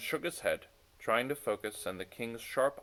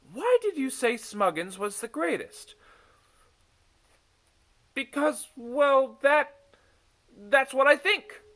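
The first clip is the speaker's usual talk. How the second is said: put-on much higher, loud childlike voice; young girl voices; 8 dB louder than usual. A narrator's reading. Misha stumbles answering Sasha, high girlish voice. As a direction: squeak it out like a hesitant little girl.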